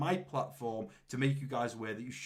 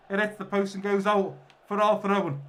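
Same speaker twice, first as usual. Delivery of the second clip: ordinary talk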